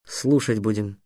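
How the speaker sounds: low, subdued reply; quiet resignation; sad. A narrator's reading. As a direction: answer glumly, soft and low. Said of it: "грустно сказал Трикс".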